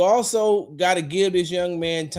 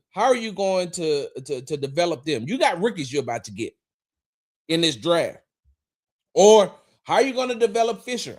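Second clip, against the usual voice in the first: usual speaking voice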